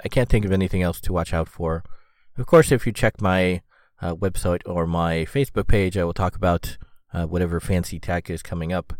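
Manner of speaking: ordinary delivery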